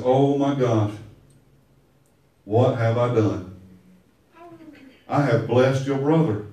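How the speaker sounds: low, soft horrified realisation; old father grasping his mistake, low and hushed; dismayed old Isaac. Retold or imitated. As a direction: let it sink in, quietly appalled.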